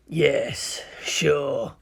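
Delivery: grudging, sinister warrior voice; dark villain's grudging reply; ground out. Acted. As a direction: force it out reluctantly, word by word. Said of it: "ground out the Thousand Son".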